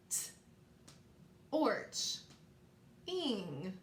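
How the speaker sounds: "T, orch, ing" with long pauses between parts; word in parts. Shown as a demonstration. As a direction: sound out "T, orch, ing", the T as a bare consonant. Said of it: "Let's say these parts".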